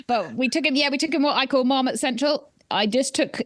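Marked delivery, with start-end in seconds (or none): none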